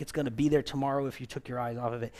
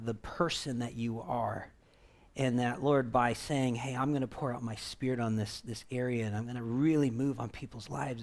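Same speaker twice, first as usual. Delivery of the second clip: prayer; 2 semitones lower than usual. Real, not acted. Pastor leads prayer.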